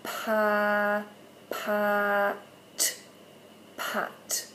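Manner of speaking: slow "pa, pa, t", then "pat" whispered; blending demo. Shown as a demonstration. Teacher modelling blending for parents, ending whispered. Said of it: "So we're gonna go".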